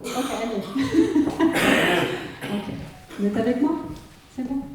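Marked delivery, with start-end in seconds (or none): 0.7-2.4 high, drawn-out laughter; real laugh. Real, not acted. Medium unsure which name to say, laughing out loud. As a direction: burst out laughing, pitched up. Laughs over Anne or Annie confusion.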